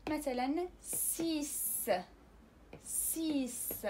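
سيس drawn out very slowly, ending on a hissed s; French سيس, slow, final x as s; slow s sound. Shown as a demonstration. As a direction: stretch سيس, ending on a clear s. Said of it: "الصوت الثالث اللي غادي نسمعوه هو س".

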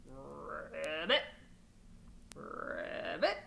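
very low ribbits; frog croak. Acted. Frog heard in story.

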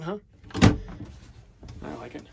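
usual speaking voice

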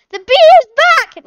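very high, very loud frightened shriek; panicked child scream. Acted. Emily spotting the bee again, a shrieking scream. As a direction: scream it like a terrified kid.

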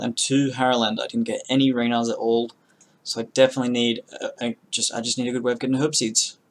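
ordinary talk, nothing marked

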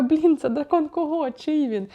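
high, puzzled self-quote; acted bewilderment. Reader recalling confusion over whose dragon it is, high and bewildered. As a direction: replay being baffled, pitched well up. Acts out her own confusion.

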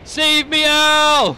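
high, loud pretend plea; mock cry for help. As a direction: yell for rescue, hammed up. Calling out for help in game.

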